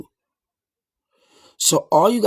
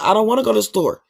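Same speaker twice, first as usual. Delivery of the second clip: louder, higher flat refusal; firm refusal; 6 semitones higher, 4 dB louder than usual. Retold or imitated. Refusing the Coke run, loud firm no. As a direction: say no to the store run loud and plain. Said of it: "But me, I be like".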